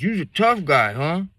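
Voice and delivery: put-on high, loud drunken sneer; drunk taunt. Drunk stranger sneering at the armed teller, high voice. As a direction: needle him like a drunk. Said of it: "they drunk, slurring their words".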